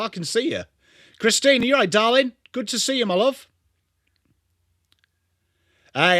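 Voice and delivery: usual speaking voice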